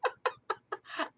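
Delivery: high, breathy laugh at failing the accent; embarrassed laugh. Real, not acted. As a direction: laugh it off after botching the English accent. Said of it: "I can't do an accent".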